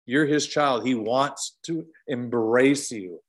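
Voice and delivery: ordinary delivery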